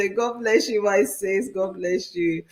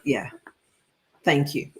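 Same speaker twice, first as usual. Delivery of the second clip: usual speaking voice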